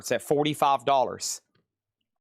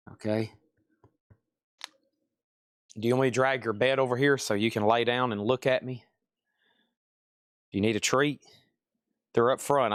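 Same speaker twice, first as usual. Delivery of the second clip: reassuring the dog; 4 semitones lower than usual. Real, not acted. Soothing whining dog for minutes.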